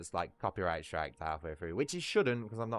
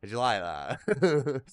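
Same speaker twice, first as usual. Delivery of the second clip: sexy reading voice; 5 semitones higher, 9 dB louder, much slower than usual. Shown as a demonstration. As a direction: purr it like a late-night host. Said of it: "my sexy reading voice".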